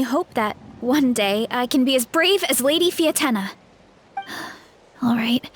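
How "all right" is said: sighing. Real, not acted. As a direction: let the breath out first. Sighs before moving on.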